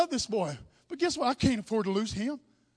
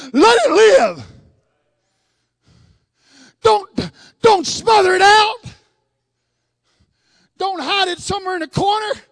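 loud, higher pleading cry; 6 semitones higher, 10 dB louder than usual. Acted. Preacher crying out like Solomon's mother, loud and high.

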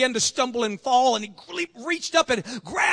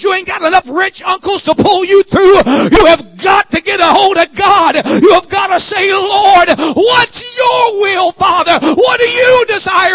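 full-force preaching; 6 semitones higher, 10 dB louder than usual. Real, not acted. Preaches to a shouted climax.

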